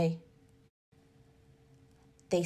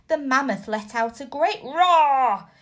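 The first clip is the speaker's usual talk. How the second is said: ordinary delivery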